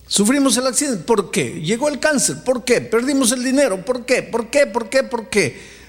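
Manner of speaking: high-pitched, repeated pleading whys; acted string of protesting questions; insistent questioning. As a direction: mimic people demanding answers from God. Preacher voices people questioning God.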